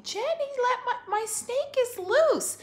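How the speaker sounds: high, loud, slow panicked yell; loud mock panic; alarmed shout. Retelling the snake alarm.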